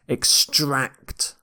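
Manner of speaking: EXTRACT stressed on the end, ex-TRACT; second-syllable stress. Shown as a demonstration. Teacher demonstrating the verb, EXTRACT with second-syllable stress. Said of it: "the second syllable here".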